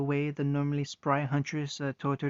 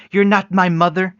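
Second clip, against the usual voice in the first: screaming it at her mother; furious shout; 4 semitones higher, 15 dB louder than usual. Acted. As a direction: yell it with raw fury. Daughter raging at her mother.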